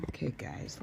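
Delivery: ordinary talk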